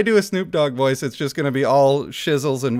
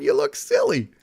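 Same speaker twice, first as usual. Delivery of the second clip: mock high voice; 13 semitones higher than usual.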